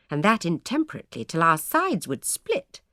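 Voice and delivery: ordinary delivery, nothing marked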